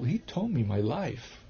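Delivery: "he told me my life" said slowly, each word weighted; slow, weighted telling. Real, not acted. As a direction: let it sink in, word by word. Slowed restart for emphasis.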